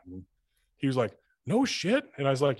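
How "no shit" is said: high, delighted disbelief in imitation; mock astonishment pitched up high; amazed no shit. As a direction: play the friend thrilled and surprised. Friend stunned by job offer.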